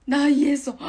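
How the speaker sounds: sudden loud realization; excited shout. Real, not acted. Realizes mic was blocked.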